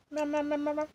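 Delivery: high, soft meowing; cat meowing. Pretend. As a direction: meow like a cat.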